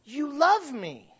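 put-on slow, drawn-out little girl's "You love me"; little daughter pleading. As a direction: coax like a child who wants her way. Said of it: "she goes".

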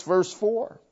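ordinary talk

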